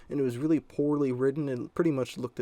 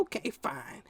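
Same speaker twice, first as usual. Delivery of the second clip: high, softer grudging agreement; imitated mom; 6 semitones higher, 5 dB quieter, slower than usual. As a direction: give in as the mom, pitched up and quieter. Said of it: "she was all".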